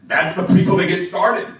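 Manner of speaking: ordinary delivery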